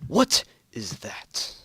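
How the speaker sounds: slow, breathless whispered panic; panicked whisper. Acted. Astronaut on the moon freezing at the sight, whispering in panic. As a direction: whisper it as if frozen in fear. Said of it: "Came his panic-ridden voice".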